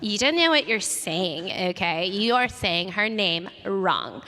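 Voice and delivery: slow, mock-offended correction; hammed-up rude correction, slow and deliberate; petty indignation. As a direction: draw each word out as if scolding a fan. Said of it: "pretty petty of me to be like".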